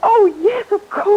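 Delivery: light up as you finally get it; breathless, eager understanding; excited realization. Acted. Sudden realization.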